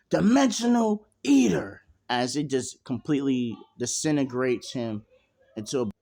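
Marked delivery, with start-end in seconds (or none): 0.1-1.8 high, loud anime attack call; attack name shout. Acted. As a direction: belt the move name out high. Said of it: "and he says".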